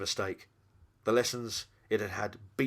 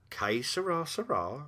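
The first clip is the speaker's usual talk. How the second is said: flippant; 7 semitones higher, slower than usual. Acted. Jack's flippant quote to the demon.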